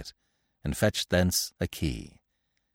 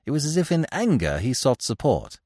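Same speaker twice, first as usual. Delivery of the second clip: ordinary delivery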